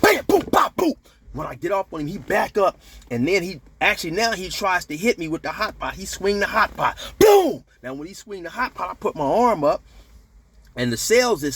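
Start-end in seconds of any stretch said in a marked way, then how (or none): 0.0-0.9 rapid high, loud punch sounds; imitated punch noises. Voicing punches in fight story. 7.2-7.6 high, loud "boom" for a swung blow; imitated impact boom. Voicing swung hot pot's blow.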